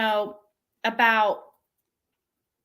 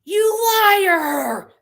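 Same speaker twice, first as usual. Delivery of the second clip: high, loud hammed-up shriek; imitating a furious text; mock angry shout; 8 semitones higher, 7 dB louder than usual. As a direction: scream it like a betrayed friend. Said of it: "she's like".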